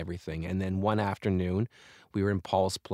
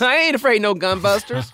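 laughing parody; 12 semitones higher, 8 dB louder than usual. Real, not acted.

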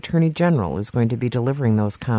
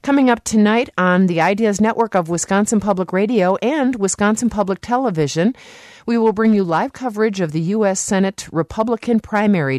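higher-pitched, polished station promo; radio announcer read; 7 semitones higher than usual. Real, not acted. Host promoting tonight's debate coverage to listeners, announcer read. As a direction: announce tonight's programme to the audience.

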